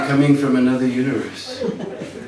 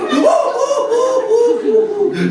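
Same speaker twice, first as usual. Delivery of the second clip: mock boyish cackle; high giggling laughter. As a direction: laugh at him like naughty boys. Boys laughing.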